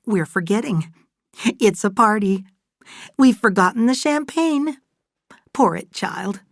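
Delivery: high, excited motherly voice; jolly the child along in a lifted, high voice; Fay, festive and coaxing. Acted.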